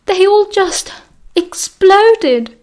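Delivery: put-on high, shaken cry of a startled girl; distressed girl. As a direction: blurt it out high and upset.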